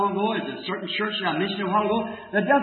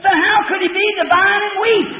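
higher, louder taunting question; a higher, louder imitation of the challenging woman; mocking challenge; 11 semitones higher, 5 dB louder than usual. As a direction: throw the question back at him, loud. Woman challenges him, shouted.

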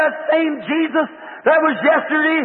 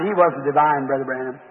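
low, much softer mock voice of the doubting woman; mimicking the woman; 11 semitones lower, 4 dB quieter than usual. Preacher mimics the woman arguing with him, low and hushed. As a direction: drop into a hushed, lower imitation of her. Quoting the woman who denied divinity.

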